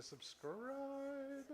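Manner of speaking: high drawn-out ghostly subscribe; spooky voice. Pretend. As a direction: stretch it out like a haunting ghost. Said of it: "I should say it like the spooky".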